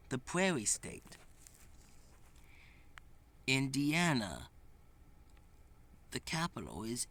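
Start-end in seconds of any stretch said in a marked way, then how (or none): none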